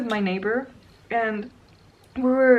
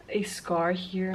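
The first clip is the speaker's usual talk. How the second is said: ordinary talk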